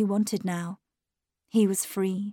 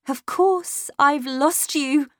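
slow, higher, upset voice; anguished farewell; 6 semitones higher, slower than usual. A narrator's reading. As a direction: give the child up in distress. Governess's bitter farewell to child.

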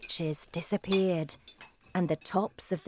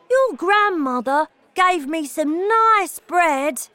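put-on high, loud, drawn-out boy-mouse voice; Bruno's mouse voice; 10 semitones higher, 8 dB louder, slower than usual. A narrator's reading. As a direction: pipe up slowly and loudly as a little mouse. Bruno the mouse speaks in story.

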